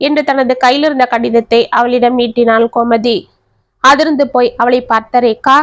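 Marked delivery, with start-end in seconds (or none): none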